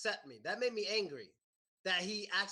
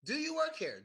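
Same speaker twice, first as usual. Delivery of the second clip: "Do you work here?" asked much higher, as a quiz to the class; higher quiz question; 7 semitones higher than usual. Shown as a demonstration. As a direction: pitch "Do you work here?" up high, inviting an answer. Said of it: "How about this question during small talk?".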